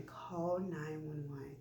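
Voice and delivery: hushed, slow retold whisper. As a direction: keep it low and secretive, drawn out. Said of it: "I'm, like, whispering".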